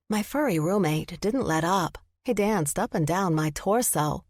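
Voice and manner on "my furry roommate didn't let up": ordinary delivery, nothing marked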